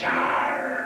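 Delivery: long, low lion roar; deep mimicked jungle roar; lion's roar. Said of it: "It roars".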